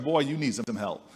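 deadpan it like an unimpressed elder; wry old woman. Retold or imitated.

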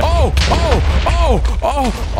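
high, loud panicked oh oh oh; real panic as the blocks fall; panicked yelps. Real, not acted. Nearly killed by falling blocks.

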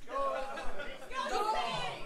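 "Google it" stretched very slowly with a rising end; drawn out. Shown as a demonstration. Telling the crowd to look up Lemon Party, drawn out. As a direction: drag out "Google it" teasingly. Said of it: "If you don't know Lemon Party".

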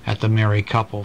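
ordinary delivery

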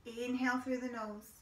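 calm, steady breathing cues; guided meditation. Acted.

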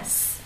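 a long hissing s; hissed s sound. Shown as a demonstration. Teacher demonstrating the s ending, hissed. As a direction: hiss out a sharp s. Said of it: "Vậy là xì ra".

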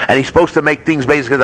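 normal talking voice

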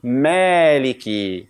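slow Maliki with a light, thin ma. Shown as a demonstration. Teacher showing the correct Maliki slowly. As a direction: draw out Maliki, keep the ma light. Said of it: "So we should say what?".

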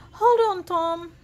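high, loud rescue call; shouting. A narrator's reading. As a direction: shout to someone in danger. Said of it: "shouted Grand Dude".

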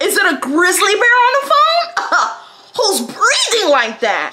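high-pitched incredulous wail through laughter; laughing mock dismay. Mocking the heavy breather on the phone, laughing disbelief. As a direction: cackle and cry out in disbelief, drawn out.

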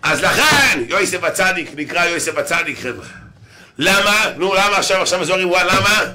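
loud, high-pitched preacher's declamation; preaching. Pretend. Preacher putting a question to the crowd, loud preaching. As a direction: call it out like a fired-up preacher.

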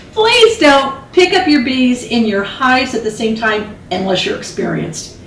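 usual speaking voice

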